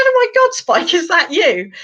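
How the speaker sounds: high-pitched, hammed-up disbelief; astonished. Tipsy friend addressing a talking bearded dragon, astonished. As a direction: act out amazement, pitch leaping right up.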